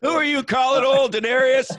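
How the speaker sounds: playful fake indignation, name jumps high and loud; mock offended. Host teasing his guest about calling him old, mock indignant. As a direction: tease him as if insulted.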